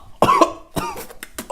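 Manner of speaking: harsh coughing after a bad bite; disgusted coughing fit. Real, not acted. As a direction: cough hard on something foul. Choking on stale cracker.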